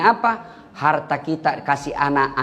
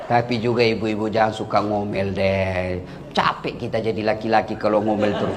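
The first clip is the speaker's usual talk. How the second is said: low pleading aside; 9 semitones lower than usual. Acted.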